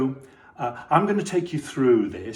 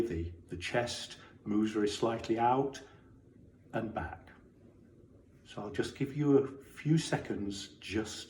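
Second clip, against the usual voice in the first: guided body scan; 6 dB quieter than usual. Acted. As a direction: guide them gently through the body, leaving space.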